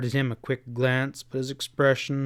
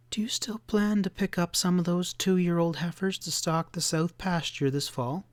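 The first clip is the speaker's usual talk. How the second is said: muffled, hesitant, a little higher; 6 semitones higher than usual. Acted. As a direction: ask it hesitantly through a muffled voice. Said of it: "In a curiously muffled voice, she asked hesitantly".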